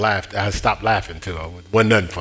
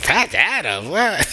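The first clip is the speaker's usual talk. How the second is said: higher, scoffing mock listener; playfully incredulous, rising on what; mock disbelief; 8 semitones higher, slower than usual. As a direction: imitate a listener scoffing at the movie. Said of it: "I know somebody's like".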